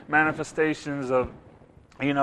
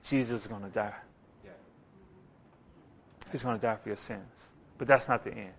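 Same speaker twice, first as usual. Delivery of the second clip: low, much softer solemn prophecy; hushed prophets; 8 semitones lower, 12 dB quieter than usual. Retold or imitated. Moses and Elijah telling the disciples of Jesus's death. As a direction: hush it like a sacred secret.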